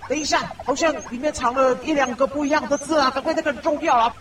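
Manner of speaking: usual speaking voice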